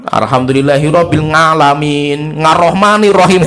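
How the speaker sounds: slow chant-like "ngalamin. Ngarrohmanirrohim", each opening on a nasal ng; faulty ng recitation. Shown as a demonstration. Teacher demonstrates a fatal recitation mistake, ng in "ngalamin". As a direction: draw it out, putting "nga" into "ngalamin" and "Ngarrohmanirrohim". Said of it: "Ini kan fatal sekali".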